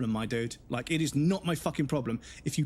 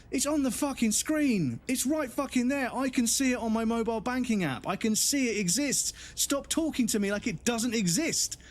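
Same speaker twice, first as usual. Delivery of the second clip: much higher, pushed frustrated complaint; exasperated rant; 8 semitones higher than usual. Real, not acted. Venting about the phone agent, pitched up and fed up. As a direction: vent like you're fed up with being ignored. Real exasperation at bank call.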